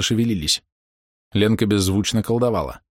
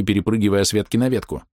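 ordinary delivery, nothing marked

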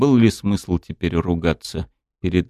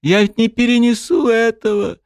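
sobbing scream; 14 semitones higher than usual. A narrator's reading. Dryad sobbing into the goddess's chest, pleading. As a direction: beg through sobs. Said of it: "рыдая ... продолжала кричать".

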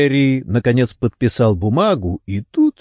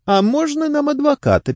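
high-pitched interjection of a worried wife; interrupting. A narrator's reading. Wife breaks into Gary's confession to detectives, interrupting in high voice. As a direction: cut in with a high-voiced request. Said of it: "перебила его Дилайн".

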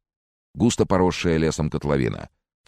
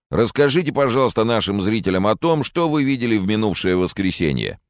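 higher-pitched reporter's prompting; meaningful tone; 6 semitones higher, 3 dB louder than usual. A narrator's reading. Reporter asks the witness about Sunday, meaningful raised tone. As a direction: put the question with on-air significance. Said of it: "многозначительным тоном обратился".

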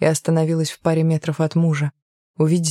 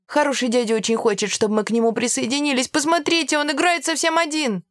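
put-on high, quick, eager kid voice; pleading child; 8 semitones higher than usual. A narrator's reading. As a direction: rattle it off like a child coaxing teacher. Children beg teacher to let them play.

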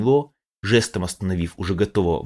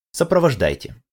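higher, louder with a hint of mockery; slight smirk; 7 semitones higher, 4 dB louder than usual. A narrator's reading. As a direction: let a faint sneer through. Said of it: "уловил в этом «сопровождайте» лёгкую усмешку".

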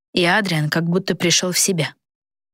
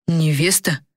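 astonished; slower than usual. A narrator's reading. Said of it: "изумился он".

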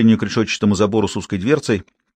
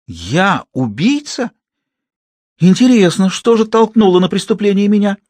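offended outcry; 9 semitones higher, 4 dB louder than usual. A narrator's reading. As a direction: cry out, stung and indignant. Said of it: "вскричала Сюзанна дрожащим от обиды голосом".

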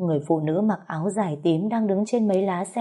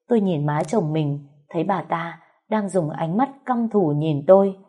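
plain reading voice

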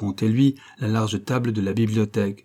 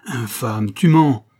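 furious shout; 6 dB louder, slower than usual. A narrator's reading. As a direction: hurl the accusation at him. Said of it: "s'écria le comte".

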